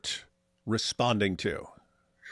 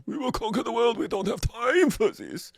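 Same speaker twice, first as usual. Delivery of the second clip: mock German; 10 semitones higher than usual. Mocking humourless Germans.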